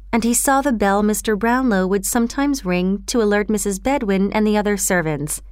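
ordinary delivery

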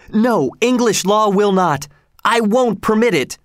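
high-pitched outcry without much extra volume; indignant shout. A narrator's reading. Young king protests a slave's hanging, indignant high cry. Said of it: "Edward shouted out".

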